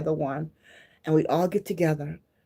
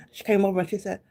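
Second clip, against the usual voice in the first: usual speaking voice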